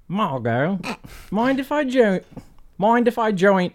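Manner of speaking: Nigel voice. Acted. Player voicing Nigel character.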